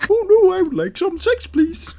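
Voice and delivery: very high falsetto begging with laughter; mock pleading cheater. Ridiculing someone too weak to hold off, falsetto mock plea. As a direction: squeal it like a pathetic caricature.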